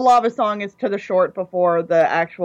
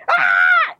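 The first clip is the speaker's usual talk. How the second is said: very high, loud alarmed "Ah!"; panicked scream; 4 dB louder than usual. Retold or imitated. Boy's emotions panicking as she skates up, high scream. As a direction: shriek like the alarms just went off. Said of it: "Everyone's running around going".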